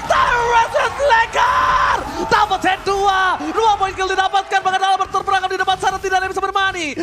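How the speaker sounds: high, loud hyped play-by-play; excited shout. Real, not acted. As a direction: scream the fight call. Caster hype at big fight.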